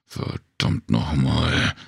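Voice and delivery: low, slow curse, a touch of anger; muttered curse. A narrator's reading. Worker swearing at the stuck wheel, slow and low. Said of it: "hörte sie den Mann fluchen".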